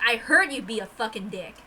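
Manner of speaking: ordinary delivery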